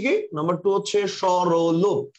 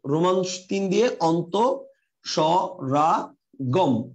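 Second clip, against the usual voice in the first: "অন্তঃস্বরাগম" drawn out syllable by syllable; slow dictation; 3 semitones lower, much slower than usual. Shown as a demonstration. Teacher giving students the new heading, slow dictation. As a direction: dictate slowly, breaking "অন্তঃস্বরাগম" into separate parts.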